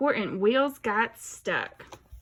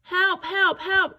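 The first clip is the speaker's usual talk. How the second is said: high, loud cries for help; crying for help; 8 semitones higher, 4 dB louder, slower than usual. A narrator's reading. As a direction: call out for rescue, stuck in the mud. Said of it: "cried the little blue truck".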